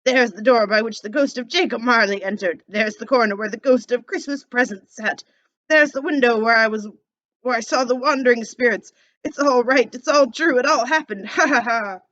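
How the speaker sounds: put-on old Scrooge, loud and giddy with joy. Scrooge calling out in delight on Christmas morning. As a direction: bounce the words out like a thrilled old man.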